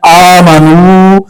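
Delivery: आमनू held very slowly with long आ and नू; teacher modelling the right आमनू; very slow, lengthened. Shown as a demonstration. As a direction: draw out every vowel of आमनू. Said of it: "नहीं पढ़ना है".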